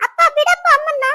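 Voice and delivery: high, urgent cries to stop; alarmed pleading. Acted. Daughter stopping father hurting mother, alarmed pleading.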